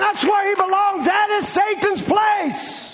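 high, driving sermon shout; rally the congregation with fierce defiance; impassioned preacher hammering out each declaration; full-force preaching. Real, not acted.

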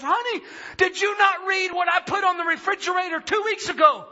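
high-pitched, urgent disbelieving wife; crying wife. Retold or imitated. Wife asks husband about her note, emotional and incredulous. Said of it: "My wife starts crying".

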